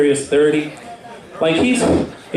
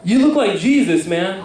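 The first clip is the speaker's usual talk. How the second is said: teasing stranger, pitched way up; street guy's mock compliment; 8 semitones higher than usual. Stranger on the street teasing the comedian, high mock heckle. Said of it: "He's like".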